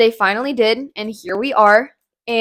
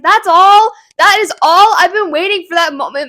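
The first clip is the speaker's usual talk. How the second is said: much higher and louder, indignant disbelief; excited outrage; 8 semitones higher, 6 dB louder than usual. Retold or imitated. Reader reacting to the book's brief reveal, loud and indignant. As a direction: burst out in disbelief at the short ending. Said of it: "I'm getting too excited".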